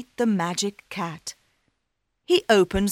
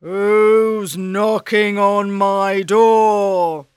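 loud, slow ogre growl; 9 dB louder, much slower than usual. Acted. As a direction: boom it out like a big ogre. Ogre answers door.

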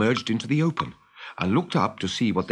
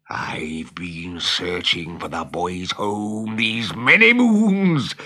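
villainous Captain Hook; 6 semitones lower, much slower than usual. Acted. Hook complains of his long search for the boys' home, villainous. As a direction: draw it out menacingly, then swell.

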